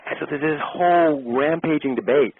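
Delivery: ordinary talk, nothing marked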